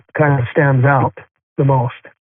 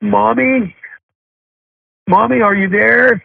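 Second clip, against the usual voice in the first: imitating a childlike call, raised high; higher, drawn-out plea for mommy, imitated; calling for mother; 7 semitones higher, slower than usual. Son imitating his dying mother calling out to her mother, higher-pitched call. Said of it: "she started calling out to her mother".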